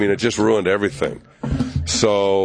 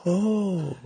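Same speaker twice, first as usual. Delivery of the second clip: high strained "Oh" breaking into a pained chuckle; high wince then a forced little laugh. Retold or imitated. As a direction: act out a boss hiding his disgust with a high "Oh" and a laugh. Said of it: "He would go".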